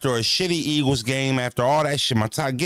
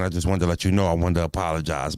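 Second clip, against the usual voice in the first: 1 lightly voiced dad apology; 7 semitones lower than usual. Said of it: "He like".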